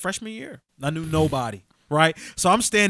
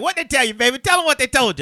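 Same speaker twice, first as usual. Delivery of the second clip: grandma egging on; 6 semitones higher, 5 dB louder than usual. Retold or imitated. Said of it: "she'd be like".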